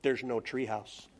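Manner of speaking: put-on serious kid. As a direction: state it gravely like a let-down child. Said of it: "got all serious".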